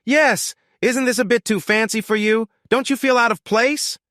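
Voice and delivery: mock him with a high snide sneer. A narrator's reading. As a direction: jeer at him, all contempt. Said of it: "Shithead sneered".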